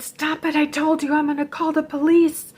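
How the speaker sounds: high, urgent re-enacted threat; acted-out desperate ultimatum; exasperated warning. Re-enacted second threat while beaten.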